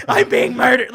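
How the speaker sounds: loud, laughing fake distress call; mock scream. Kid pretending to phone police, mock scream. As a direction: play at being a murder victim, loudly. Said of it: "pretend to call the police and scream".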